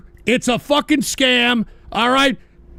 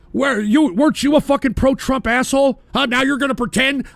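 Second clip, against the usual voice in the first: ordinary talk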